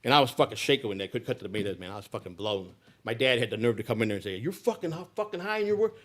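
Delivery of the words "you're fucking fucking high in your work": mocking, higher-pitched dad accusing; imitating dad's angry accusation. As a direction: throw the accusation at him like his father. Father confronts high son in kitchen.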